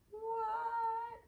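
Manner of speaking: high squeal of surprise; a delighted gasp at the pop-up book; surprised. Real, not acted.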